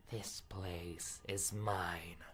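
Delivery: slow, soft whisper; a slow whispered warning that she owns this place; whispered threat. Acted. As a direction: breathe the claim slowly. Princess's final threat.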